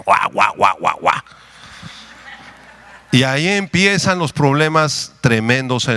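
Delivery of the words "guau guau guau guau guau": low imitated dog barks; hammed-up "guau" barking; mock barking. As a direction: imitate a quarrel as a run of low woofs. Mimics the couple's quarrel as barking.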